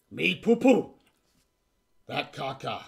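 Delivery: crude goblin speech. Acted. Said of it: "The goblin goes".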